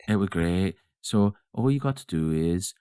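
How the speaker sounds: lightly imitated Lancashire voice; a hint of friendly Lancashire; Lancashire accent. Shown as a demonstration. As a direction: have a go at slow Lancashire. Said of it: "Can you do a Lancashire accent?".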